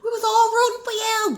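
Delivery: high, loud wail of betrayal; over-the-top disappointed shout; mock outraged yell. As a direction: yell it like a hammed-up scolding. Mock outraged quote, yelled.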